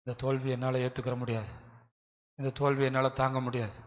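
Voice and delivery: quiet, grieving plea before the ark; a touch of tearful despair, hushed; mild weeping plea. Acted. Joshua pleading before the ark, quietly grieving. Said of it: "அழுகுறான்".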